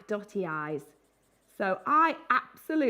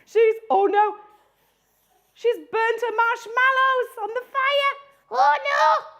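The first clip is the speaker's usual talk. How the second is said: much higher, louder hammed-up dismay; distraught granny; 12 semitones higher, 8 dB louder than usual. Granny burnt her marshmallows, high loud mock despair. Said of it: "if our granny had different feelings".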